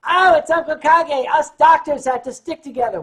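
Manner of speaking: creepy cheerful fan greeting; imitating an eager fan. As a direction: play the overfamiliar stranger rushing up. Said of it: "I heard a voice say".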